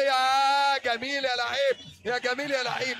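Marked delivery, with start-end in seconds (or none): 0.0-1.8 slow, loud, stretched-out cry of admiration; drawn-out praise. Real, not acted. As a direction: savour every word, loud and slow. Praising player after skilled move. (إعجاب متحمس بصوت عالٍ وممدود)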